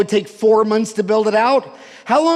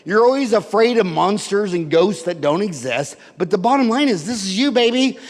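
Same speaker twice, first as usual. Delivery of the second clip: ordinary talk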